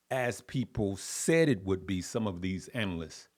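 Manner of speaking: normal talking voice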